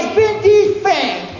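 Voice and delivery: "it's been defanged" yelled high and loud, slow; mocking shout. The wrangler yells "it's been defanged" back at the actor, high and loud. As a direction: bellow "it's been defanged" in scornful disbelief. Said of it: "She goes".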